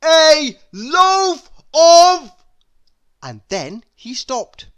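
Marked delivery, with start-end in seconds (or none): 0.0-2.3 very loud, high, slow yell; top-of-voice shout. A narrator's reading. Mister Noisy yelling at the baker before stopping himself. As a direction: yell it at full force, then cut off. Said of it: "shouting at the very top of his voice".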